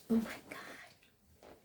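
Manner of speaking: breathless swooning whisper. Pretend. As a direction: whisper it like you're smitten. Swooning over a handsome man.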